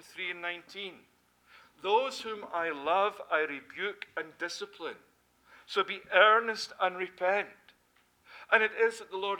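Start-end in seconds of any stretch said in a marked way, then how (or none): 1.8-7.5 scripture reading. Acted. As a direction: proclaim the verse with force. Reads scripture with force.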